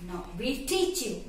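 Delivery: usual speaking voice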